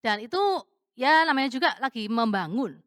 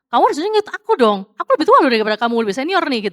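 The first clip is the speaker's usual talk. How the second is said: loud, insistent, rushed claim of seniority; pushy demand; 8 dB louder than usual. Retold or imitated. To a colleague, insisting she's the senior, pushy and loud. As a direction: push your rank on him, fast.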